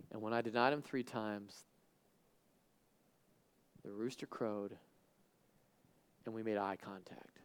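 low, hushed, halting recollection; acted regret, very soft and low; subdued confession. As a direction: confess it quietly with long pauses. Acted Peter confessing his denial.

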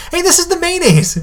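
sudden high excited "Hey, this is the mayonnaise"; surprised realisation. Real, not acted. Host spots a familiar ingredient in the article, delighted surprise. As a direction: jump up in pitch, delighted to spot it.

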